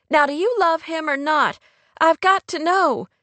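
high, insistent demand; sharp. A narrator's reading. Kate demands to know if Rory loves him, sharply. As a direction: press sharply for an answer. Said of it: "sounding quite unlike her usual self".

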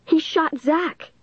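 high, slow, drawn-out cry; high-pitched outcry, each word stretched; accusing cry. A narrator's reading. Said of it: "Dennis cried".

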